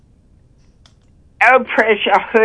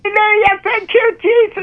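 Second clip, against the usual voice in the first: ecstatic praise; 9 semitones higher, faster than usual. Real, not acted. Ecstatic praise after healing.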